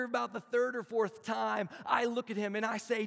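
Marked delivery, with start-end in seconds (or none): none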